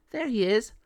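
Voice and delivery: high, quick female officer voice; friendly pound officer. Acted. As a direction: greet a visitor in a high-pitched voice. Officer shows Monty to Ken.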